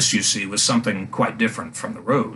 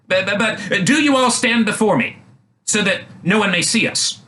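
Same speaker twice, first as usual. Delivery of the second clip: princess's voice; 9 semitones higher than usual. A narrator's reading. Princess commands ladies.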